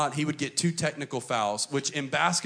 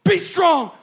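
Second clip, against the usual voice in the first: furious high shout, much louder; coach yelling; 13 dB louder, slower than usual. Retold or imitated. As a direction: scream it with veins popping. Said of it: "This is how he would talk".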